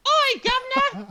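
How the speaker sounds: very high, loud falsetto English woman; hammed-up falsetto drag voice; Monty Python female character. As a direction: screech it as a sketch-comedy English lady. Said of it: "traditional female character from Monty Python".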